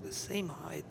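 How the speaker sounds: usual speaking voice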